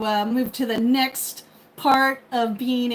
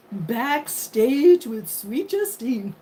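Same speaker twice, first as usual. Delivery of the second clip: show title announcement; much slower than usual. Pretend.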